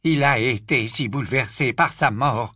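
ordinary delivery

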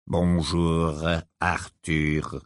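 low, slow, grave-deep voice; sepulchral voice. Acted. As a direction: speak like a voice from the tomb. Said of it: "d'une voix sépulcrale".

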